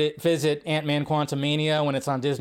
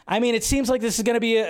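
ordinary talk, nothing marked

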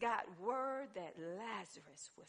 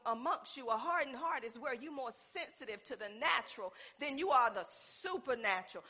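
usual speaking voice

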